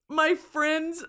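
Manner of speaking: normal talking voice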